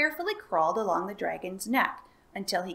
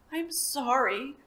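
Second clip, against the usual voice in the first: huffing; 5 semitones higher, slower than usual. A narrator's reading. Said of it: "huffed the dragon".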